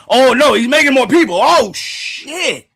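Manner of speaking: very high, loud cry of alarm; panicked outburst. Real, not acted. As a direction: shriek it, stretching the final curse. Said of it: "Why I say it like that?".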